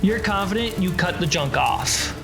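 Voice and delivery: ordinary delivery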